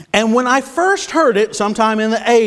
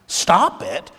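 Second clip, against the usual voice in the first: ordinary talk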